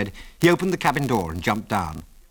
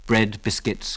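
ordinary delivery

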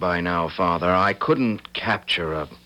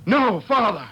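much higher terrified shout; frightened shout; 14 semitones higher than usual. Acted. Son refusing as he is bound, terrified shout. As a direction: shout in terror.

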